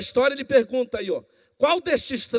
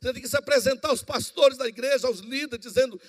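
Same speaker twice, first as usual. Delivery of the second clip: normal talking voice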